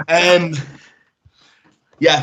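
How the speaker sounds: ordinary delivery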